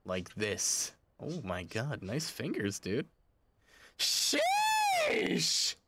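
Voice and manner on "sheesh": long, very high drawn-out exclaim; sheesh. Real, not acted. Streamer marvels at the dealer's hands, high excited sheesh. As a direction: squeal it out, impressed. Impressed by opponent's move.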